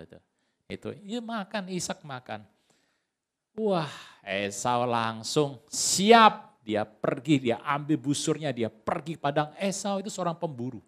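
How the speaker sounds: usual speaking voice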